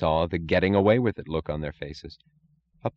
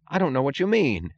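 higher, hurt and offended; injured tone; 8 semitones higher than usual. A narrator's reading. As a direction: sound wounded by the accusation. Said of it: "in an injured tone of voice".

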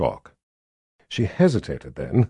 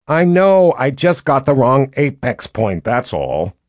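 huffy; 4 semitones higher, 6 dB louder than usual. A narrator's reading. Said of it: "Violet huffed".

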